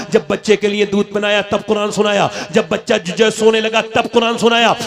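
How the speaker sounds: loud, rhythmic, repeated refrain; loud pulpit delivery building in force; forceful preaching. Real, not acted. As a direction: drive each example home loudly.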